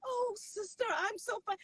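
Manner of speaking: high-pitched put-on church pleasantry; phony churchgoer. Churchgoer answering a sister, phony and high-pitched. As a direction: imitate fake sisterly politeness. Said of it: "They don't even talk like that".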